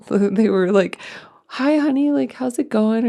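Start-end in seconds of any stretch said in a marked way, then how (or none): 1.5-3.1 put-on high, breathy sing-song greeting to a child; sweet nurse voice. Nurse greets the injured little girl, high and sweetly cooing. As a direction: coo at the kid like a kind nurse.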